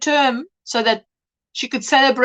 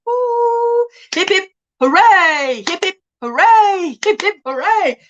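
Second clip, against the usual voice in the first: high, loud hip-hip-hooray; celebratory cheer; 6 semitones higher, 4 dB louder than usual. Real, not acted. Streamer cheering a viewer's birthday, high celebratory cheer.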